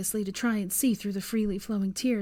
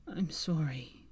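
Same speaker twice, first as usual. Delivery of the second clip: lower, softer whisper; 4 semitones lower, 6 dB quieter, slower than usual. A narrator's reading. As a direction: breathe the apology faintly. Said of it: "he repeated".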